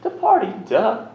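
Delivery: high, loud, cocky "duh"; smug mock answer. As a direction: toss it off like it's obvious. His own smug answer retold.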